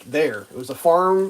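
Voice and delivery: ordinary delivery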